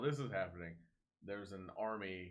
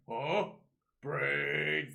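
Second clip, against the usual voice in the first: loud, raised zombie imitation; zombie cry; 7 semitones higher, 10 dB louder than usual. Zombies spotting the kid from the Jeep, mock zombie cry. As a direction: cry it out like a brain-hungry zombie. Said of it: "the zombies were like".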